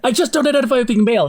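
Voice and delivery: squeaky quick imitation of the boyfriend; mock boyfriend voice. Shown as a demonstration. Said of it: "He does".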